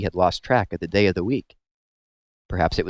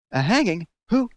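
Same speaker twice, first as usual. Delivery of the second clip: shocked; 12 semitones higher than usual. A narrator's reading. Catherine shocked by news.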